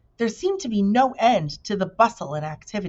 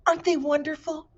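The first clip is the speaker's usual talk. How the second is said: excited; 10 semitones higher than usual. Acted. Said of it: "he cried".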